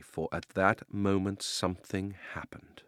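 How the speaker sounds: plain reading voice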